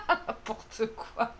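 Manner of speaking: words breathed through a laugh; slow, giggling it out. Real, not acted. Laughing at own pun. (dit en riant, lentement)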